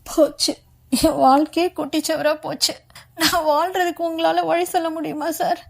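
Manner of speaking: acted tearful wail, high-pitched; sobbing plea. A narrator's reading. Ruined young woman begs Murali for a way, sobbing. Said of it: "என்று விம்மலுடன் ராஜாத்தி".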